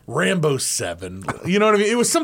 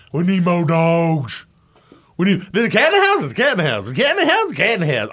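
mock coach preaching, high and loud; hammed-up coach voice; imitating the coach; 5 semitones higher, 5 dB louder, faster than usual. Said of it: "I love".

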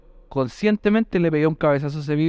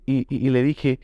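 ordinary talk

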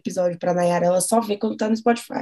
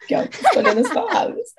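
high, laughing through the words; nervous laugh; 13 semitones higher than usual. Real, not acted. Podcaster admits she's choosing her words, nervous laughter. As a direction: laugh it out nervously, pitch jumping up. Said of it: "Tá rindo de nervoso".

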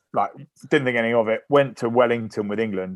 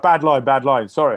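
high, loud mock excuse; flustered excuse; 5 semitones higher, 6 dB louder than usual. Player covering his mistake to the coach, acted flustered. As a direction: cover your blunder, higher and louder.

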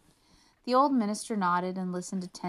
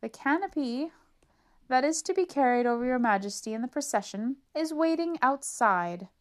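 higher, ceremonial call; formal announcement; 5 semitones higher, 4 dB louder than usual. Acted. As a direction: herald the procession. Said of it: "announced the master of ceremonies".

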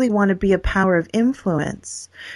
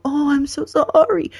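slow, higher, syrupy pity; mock pity; 6 semitones higher, slower than usual. Mocking how people answer the sick, syrupy pity. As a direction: pour on fake sympathy slowly.

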